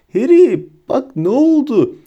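high, loud drawn-out call; tipsy high-pitched amazement; giddy, dazed Ron. A narrator's reading. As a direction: call out in woozy wonder. Dazed Ron shows what happened.

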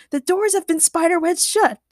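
high-pitched urgent report; shouting. A narrator's reading. Chad tells friends the doors are jammed, shouting. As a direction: raise the voice in alarm. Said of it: "Chad shouted".

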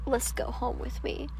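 hushed, breathy invitation to come home; whispered come-on. Retold or imitated. Drunk guy leaning in to whisper his invite. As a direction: whisper it close, like a sleazy pickup.

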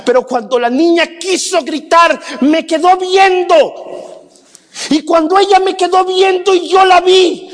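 pastor's impassioned crescendo to a shout; preaching at full force. Acted. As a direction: pitch and volume climbing into shouts. Climactic sermon story, rising to shouts.

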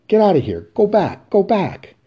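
driving her off stage; a desperate yell to go back; angry shout. Acted. Willie shouts woman out.

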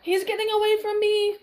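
high, loud panicked cry; frantic shout. A narrator's reading. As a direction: yell as the enemy escapes. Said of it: "Inigo said".

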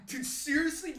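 usual speaking voice

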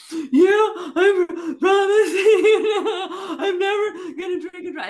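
loud, high-pitched, drawn-out mock sobbing; bawling promise. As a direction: wail it like a hammed-up drunken confession.

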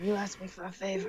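quiet mafia don menace; Godfather impression. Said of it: "the Godfather kind of thing".

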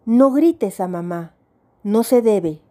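ordinary delivery

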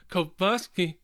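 imitating own nervous name reading; exaggerated unsure delivery; mock nervous. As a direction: haltingly sound out the name, syllable by syllable. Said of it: "You said it so nervously".

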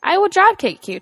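I will drop kick you shouted in jest; mock threat. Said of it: "Try again".

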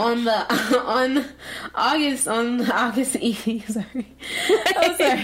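giggling through the words; can't stop laughing nervously. Real, not acted. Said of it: "I started nervously laughing".

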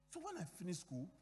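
normal talking voice

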